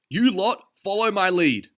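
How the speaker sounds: high, loud call to the sailors; rally them to follow; commanding. A narrator's reading. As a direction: Derville calling to the sailors. Derville ordering sailors.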